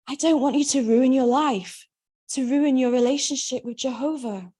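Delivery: a scared mother pleading through tears; mother, afraid for her daughter, pleads tearfully. A narrator's reading. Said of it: "She wipes her eyes with a tissue".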